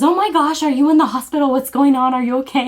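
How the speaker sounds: retold motherly alarm; very concerned mom. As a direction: fire off worried questions as a mother. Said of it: "she was very concerned".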